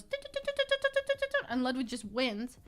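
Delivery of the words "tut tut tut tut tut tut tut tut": rapid high tongue ticks; imitated wheel clicking. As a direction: tick like a spinning roulette wheel. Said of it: "the thing goes".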